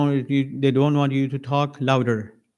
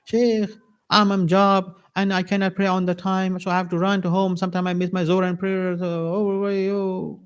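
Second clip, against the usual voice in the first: hammed-up plaintive excuse, pitched up throughout; complaining caller; 6 semitones higher than usual. Worker phones the sheikh with excuses, mock plaintive. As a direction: imitate a caller pleading and moaning, higher voice.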